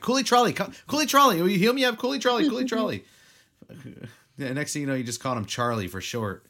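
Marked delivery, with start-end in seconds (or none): 0.0-3.1 loud, high calling out. Pretend. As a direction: call the name across the field. Calling a character by name.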